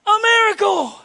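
shouted high, a burst of joy; exclaimed miracle. Acted. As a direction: belt out the miracle like good news.

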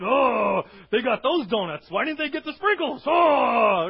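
loud exaggerated whine about the donuts; mock grumbling. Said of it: "You grumble a little bit?".

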